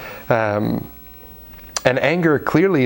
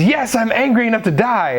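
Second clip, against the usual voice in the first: mock angry Jonah; 9 semitones higher than usual. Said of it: "And he says".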